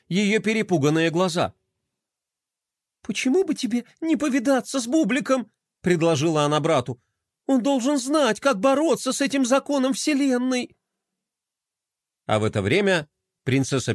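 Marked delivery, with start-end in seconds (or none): 3.0-5.5 high, small nervous voice; timid suggestion. A narrator's reading. Frightened girl in a box advising brother, timid. 7.5-10.8 high-pitched nervous voice; timid suggestion. A narrator's reading. Frightened girl in a box advising brother, timid.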